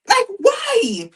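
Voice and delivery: exasperated cry. Real, not acted. A mum baffled by her kids' yelling, high exasperated cry. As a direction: wail it in fond despair.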